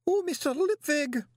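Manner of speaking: very high, distraught wail; wailing woman. A narrator's reading. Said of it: "a wailing woman rushes into a room".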